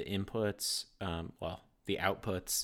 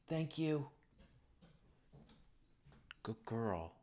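praising the dog; 6 semitones higher, 5 dB quieter, slower than usual. Real, not acted.

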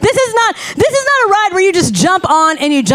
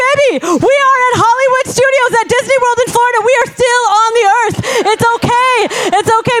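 reassuring mom; 6 semitones higher, faster than usual. Retold or imitated. Mother soothing scared daughter.

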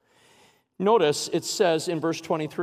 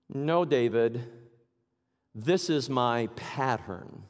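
lower, softer, slow reassurance; calm voice of God; 6 semitones lower, 4 dB quieter, slower than usual. Acted. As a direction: gently correct David. God answers David.

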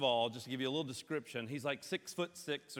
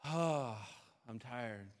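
low, soft, worn-out sigh; acted weariness; 5 semitones lower, 4 dB quieter, much slower than usual. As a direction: let it sink quietly, as if drained. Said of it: "And you're just like".

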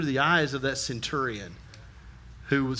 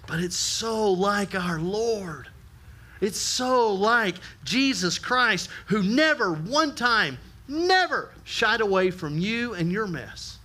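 slow emphasis; 3 semitones higher, slower than usual. Acted.

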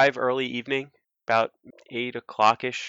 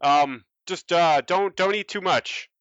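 high, hesitant, scared little plea; nervous sailor; 5 semitones higher, slower than usual. Acted. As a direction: timidly beg the huge goliath. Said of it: "he's like".

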